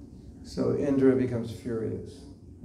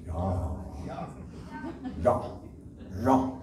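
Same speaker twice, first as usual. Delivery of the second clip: German ya; 5 semitones lower, 4 dB quieter, much slower than usual. Shown as a demonstration. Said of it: "how do I say it like a German".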